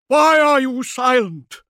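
high, loud hysterical demand; rasping hysteria. A narrator's reading. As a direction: scream the question in a rasp of panic. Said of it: "his voice rasping with hysteria".

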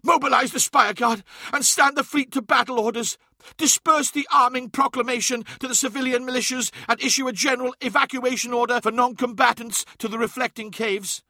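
high, urgent military orders; high-pitched, pressing command; barking orders. A narrator's reading. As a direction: bark commands to the squad, pitched well up. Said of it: "barking orders to the Scarab occult".

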